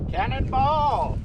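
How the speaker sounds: high drawn-out yell mid-jump; cannonball yell. Real, not acted. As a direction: shout it as you leap in. Jumping into lake, cannonball.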